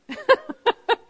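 short high-pitched laugh. Real, not acted. Preacher laughing at the doubting heart, high-pitched. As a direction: burst into a squeaky laugh.

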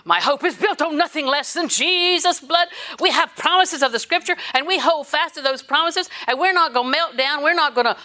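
high, loud fired-up preaching; rev up. Real, not acted. Preacher revving up the congregation about God's promises. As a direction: build to a rousing peak. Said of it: "That was kind of a rev up".

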